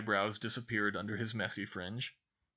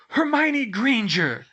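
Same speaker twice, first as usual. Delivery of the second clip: high, drawn-out yell of a name; 13 semitones higher, 11 dB louder, slower than usual. A narrator's reading. Redheads stumble in to accuse Hermione, yelling. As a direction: call out loud, high and slow. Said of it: "they yelled as they stumbled".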